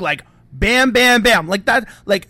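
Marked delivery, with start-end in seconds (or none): 0.5-1.5 loud, punched mock gunshots; smug gunfire. Henchman shooting the guy, smug mock gunfire. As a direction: fire off the words with smug relish. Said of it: "He has this smug".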